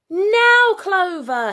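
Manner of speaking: high, loud sudden call; boy signalling his friend; urgent shout. A narrator's reading. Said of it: "he shouted".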